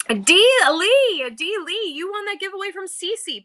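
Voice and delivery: high, loud winner announcement; announcer call-out. Real, not acted. Streamer announcing the winner to chat, high and loud. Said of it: "announces giveaway winner".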